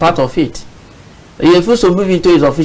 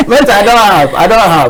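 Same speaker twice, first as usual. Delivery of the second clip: laughing denial; 6 semitones higher than usual. Real, not acted. Guest denying northern knowledge to the host, laughing. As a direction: deny it while you can't stop laughing. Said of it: "Why are you smiling?".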